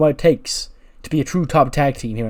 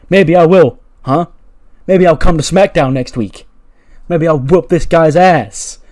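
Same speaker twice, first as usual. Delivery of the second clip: loud, raised mock fighting talk; fired-up challenge; 5 semitones higher, 10 dB louder than usual. Teacher threatening to fight Braun next week, loud acted challenge. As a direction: throw down a hammed-up challenge, high and loud.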